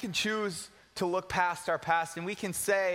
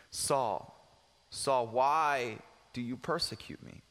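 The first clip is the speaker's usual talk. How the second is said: a hint of a deep, slow heavenly voice; lightly voiced Jesus calling from above; low, slow call of the Lord; 7 semitones lower, slower than usual. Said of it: "he heard a voice say to him".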